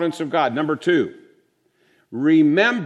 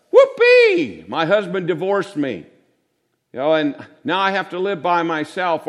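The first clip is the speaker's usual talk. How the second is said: sarcastic whoopee; 2 semitones higher, 3 dB louder than usual. Retold or imitated. Preacher mocks a churchgoer cheering at lymphoma, sarcastic shriek. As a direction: fake joy at bad news, hammed up.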